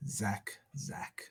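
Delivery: breathy whispered name. Real, not acted. Fan whispering captain's name in awe.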